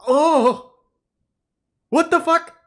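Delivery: very high, loud disgust; disgusted shriek. Real, not acted. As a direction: recoil and shriek. Reading disgusting text.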